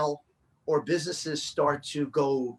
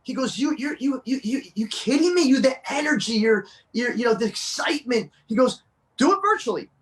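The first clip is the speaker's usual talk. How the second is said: excited Tony impression; 7 semitones higher, 3 dB louder than usual. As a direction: voice pitched up and pushed louder, all hyped up. Tony hyping him up.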